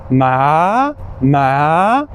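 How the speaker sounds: "ma" rising like a question; second tone ma. Shown as a demonstration. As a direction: slide "ma" upward. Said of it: "the second tone is the rising tone".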